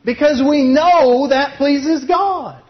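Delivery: slow, high, with "know" punched; emphatic refrain. Acted. Preacher repeats his refrain, leaning on know. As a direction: lean hard on "know".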